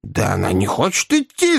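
exasperated yell from a struggling rider. A narrator's reading. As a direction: protest in a strained cry. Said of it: "завопил мистер Уинкль". (вопль досады)

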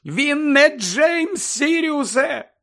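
very high, loud, drawn-out cry; worked-up, high-pitched rebuke; heated outburst. A narrator's reading. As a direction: shout each word slowly, overheated. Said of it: "аж розпашілася місіс Візлі".

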